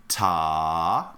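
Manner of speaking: TA held on a long, low vowel. Shown as a demonstration. Teacher showing the long note value, TA drawn out. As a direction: stretch "ta" right out. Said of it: "Our ta needs to sound longer".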